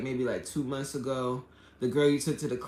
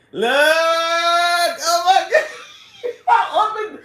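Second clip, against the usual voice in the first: shrieking, high laughter breaking through the words; losing it laughing at the chat; helpless laughter; 13 semitones higher, 11 dB louder, much slower than usual. Real, not acted. Vlogger reading the chat to viewers, laughing helplessly.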